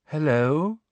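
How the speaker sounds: airy, far-off dreamy hello; vague dreamy voice. A narrator's reading. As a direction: float it out, half elsewhere. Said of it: "a vague and dreamy voice".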